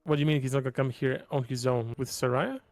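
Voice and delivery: normal talking voice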